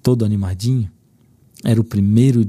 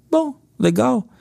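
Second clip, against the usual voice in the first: lightly voiced small boy, much higher; a boy's short reply, pitched up; child's voice; 13 semitones higher, slower than usual. Pai imita o filho respondendo. (voz de criança, bem mais aguda)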